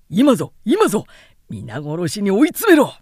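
loud urgent order to charge; rally the troops to finish them off; battle command. Acted. Commander urging the attack.

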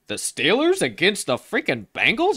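high-pitched mock disbelief; incredulous bettor. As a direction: squawk it high, as an outraged bettor. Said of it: "You're like".

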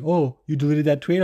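lightly voiced smug gotcha; mocking troll voice. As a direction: taunt him like a smug troll catching you out. Mimicking a Twitter troll's gotcha.

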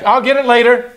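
put-on high, loud dismissive kid; mock bratty stalling; brushing off. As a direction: imitate a child putting off a chore. Voicing child putting off a chore.

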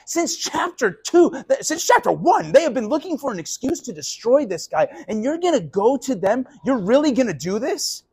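shocked accusing questions; incredulous confrontation. Acted. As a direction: challenge a friend who betrayed you. Preacher confronts Judas in role.

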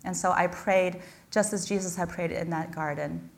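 ordinary delivery

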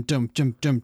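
ordinary talk

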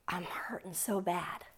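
whispered hurt, barely voiced. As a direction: breathe it out as a secret confession. Confiding pain to elders.

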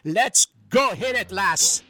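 a lightly voiced stage Irish brogue; a hint of a cheeky Irish charmer; Patio Furniture Irish brogue. Irish visitor flirting with the lovely lass, in a light Irish brogue. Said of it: "It's me, Patio Furniture".

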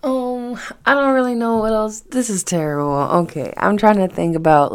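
usual speaking voice

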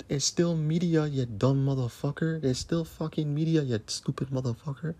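normal talking voice

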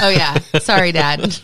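loud, laughed-out apology; laughing apology. Real, not acted. Podcaster apologizing to the co-host's dad, laughing. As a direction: say it through real laughter.

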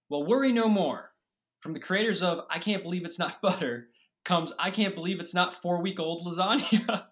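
infomercial. Pretend. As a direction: sell it like a late-night TV ad. Fake sponsored ad read.

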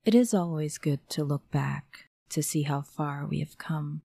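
ordinary delivery, nothing marked

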